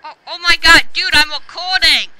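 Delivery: loud, exasperated protest; exasperated shout. Real, not acted. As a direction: complain loudly at friends interrupting the recording. Complains friends interrupt his recording.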